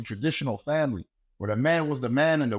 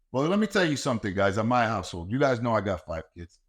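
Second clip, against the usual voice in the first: usual speaking voice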